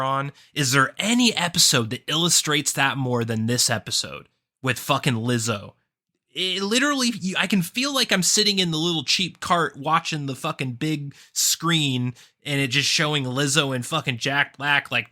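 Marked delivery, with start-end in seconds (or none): none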